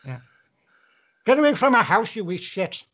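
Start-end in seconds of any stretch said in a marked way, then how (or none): 1.2-2.8 put-on Irish accent. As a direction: send someone packing in an Irish accent. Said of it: "There is that Irish".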